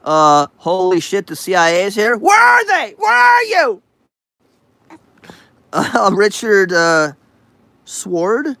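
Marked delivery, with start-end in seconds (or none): 2.2-3.8 high, loud call into the room; mock calling out. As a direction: shout out looking for them. Calling out for the CIA.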